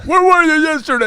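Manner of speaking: high, loud accusing voice; shrill-free mock accusation down the phone; jealous partner on the phone. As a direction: demand it like a suspicious lover. Inmates phoning partners after Cheaters.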